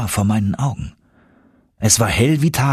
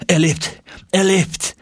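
higher, glad exclamation; joyful cry; 10 semitones higher, slower than usual. Acted. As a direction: burst out with relief and joy. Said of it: "rief er vor Freude".

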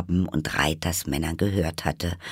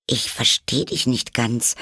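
higher-pitched polite puzzlement; carefully polite; 6 semitones higher than usual. Acted. Puzzled Alice answers the Hatter, carefully polite. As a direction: admit confusion as courteously as possible. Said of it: "so höflich sie konnte".

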